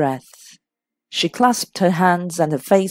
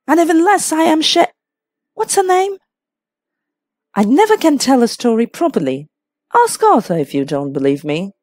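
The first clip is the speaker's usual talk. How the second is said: higher-pitched, protesting hostess; lightly voiced flustered hostess; 8 semitones higher, 4 dB louder than usual. A narrator's reading.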